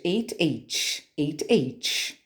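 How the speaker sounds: "eight" ending in t, "H" ending in ch; contrasting final sounds. Shown as a demonstration. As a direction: set "eight" against "H", each word separate. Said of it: "vejam que o som final é diferente".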